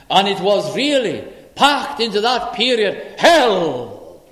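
loud, high, rising proclamation; full-force preaching. Acted. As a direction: preach it to the back of the hall. Lord explains the cross.

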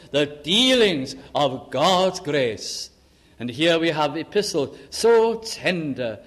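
emphatic sermon delivery with stretched key words; impassioned preaching. Real, not acted. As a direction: preach it fervently, weighting each phrase. Preaches with rising force.

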